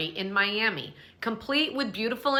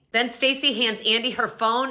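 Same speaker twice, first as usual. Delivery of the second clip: ordinary delivery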